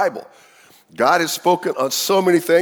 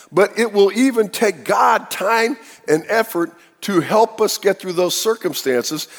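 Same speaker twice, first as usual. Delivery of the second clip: ordinary talk, nothing marked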